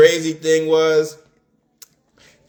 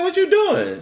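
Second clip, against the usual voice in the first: mimicking the best friend, pitched way up; high girl voice. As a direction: play the friend in a high squeaky voice.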